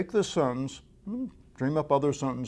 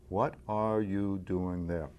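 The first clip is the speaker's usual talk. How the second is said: "What are you doing there?" slow and even, no word standing out; each word given equal weight, unhurried; no inflection; 3 semitones lower, slower than usual. Shown as a demonstration. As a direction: say it evenly, without any inflection. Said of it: "as evenly as possible without inflection".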